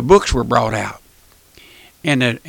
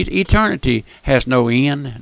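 usual speaking voice

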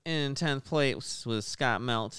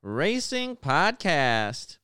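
big hype show intro; loud, punchy announcer open; 5 semitones higher, 4 dB louder than usual. Acted. Hyped podcast show opening.

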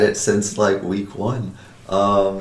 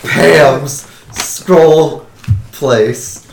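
urgent panting report; 4 semitones higher, 7 dB louder, much slower than usual. Pretend. Running in, out of breath.

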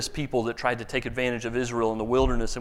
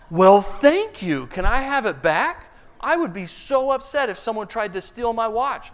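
sarcastic thanks; 7 semitones higher, 5 dB louder than usual. Retold or imitated. Pastor retorting to watch thief.

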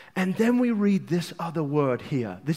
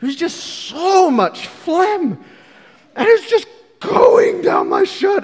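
rising to a high, loud shout; worked-up shout; 12 semitones higher, 7 dB louder than usual. Retold or imitated. Preacher reliving his own crying.

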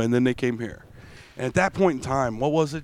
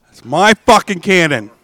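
indignant; 3 semitones higher, 8 dB louder than usual. Real, not acted. Claiming his hangout spot.

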